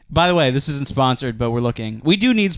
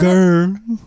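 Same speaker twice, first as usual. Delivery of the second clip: higher mock growl; impression growl; 5 semitones higher, 3 dB louder than usual. Shown as a demonstration. Showing co-host how he sounds, high mock growl. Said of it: "That's what I sound like".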